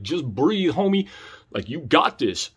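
low, hushed pep talk to oneself; self-soothing. Retold or imitated. Comic calming himself before the crowd, gently reassuring.

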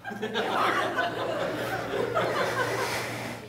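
airy laugh at his own joke; amused huff of a laugh; breathy high laugh. Real, not acted. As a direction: let a soft unvoiced laugh out.

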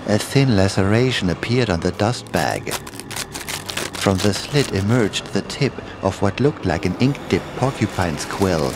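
ordinary delivery

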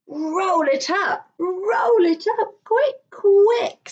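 building hurried urging; urgent mother. Acted. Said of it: "said his mother".

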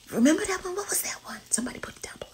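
whisper. Real, not acted. Whispered aside to viewers.

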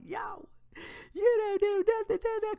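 high-pitched mocking whine of a defensive fan; mock fan outcry. Imitating fans defending their celebrities, high mocking voice. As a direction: squeal it like an outraged fan.

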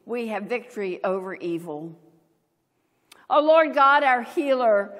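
normal talking voice

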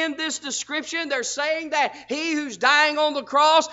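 normal talking voice

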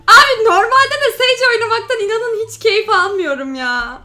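ordinary delivery

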